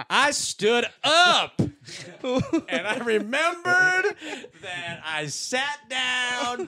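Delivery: high, loud triumphant build; outta here. Acted. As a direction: burst up with triumph, slowly. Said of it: "and then it's outta here".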